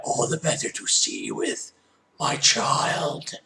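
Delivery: put-on husky wolf-granny voice; wolf posing as grandmother. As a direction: rasp it like a disguised wolf.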